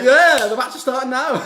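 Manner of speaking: imitating smug Charlotte announcing the match. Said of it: "Charlotte's like".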